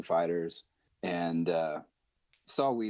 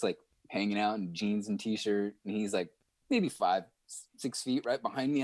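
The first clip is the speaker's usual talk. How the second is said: normal talking voice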